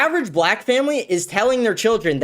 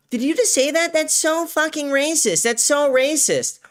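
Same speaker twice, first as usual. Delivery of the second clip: mocking whiny kids; 9 semitones higher than usual. Mocking kids on the mic, high whiny voice. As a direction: sneer it in a high kid voice. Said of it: "They're like".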